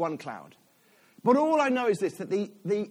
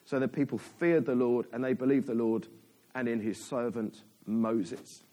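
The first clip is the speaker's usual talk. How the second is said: measured, lower-pitched Bible reading; scripture reading; 6 semitones lower than usual. A narrator's reading. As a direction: read the passage out solemnly to the congregation. Reads a Bible passage aloud.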